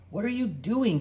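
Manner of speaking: high, loud alarmed cry; cried out. A narrator's reading. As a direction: call out in alarm. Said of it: "Carrie cried".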